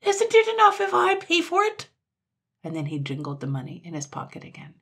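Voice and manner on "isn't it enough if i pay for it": very high, loud tantrum; outraged piercing yell; shrieking. A narrator's reading. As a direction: screech it indignantly, as if stamping. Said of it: "shrieked Sir Buzz, stamping his foot".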